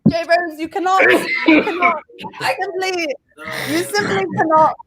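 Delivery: high-pitched, halting comic protest; mock outrage. Co-host forbidding a teasing friend to criticize, mock outraged. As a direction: splutter in pretend offense.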